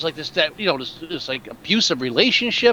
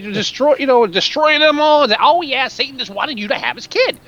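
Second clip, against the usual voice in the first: mock yell; 7 semitones higher than usual. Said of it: "he starts yelling about".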